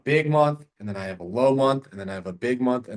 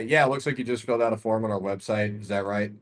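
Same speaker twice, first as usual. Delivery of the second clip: lower, lifeless, flat read; robotic monotone; 3 semitones lower than usual. Shown as a demonstration. Caller reading script to seller like a robot. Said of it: "I sound like a robot".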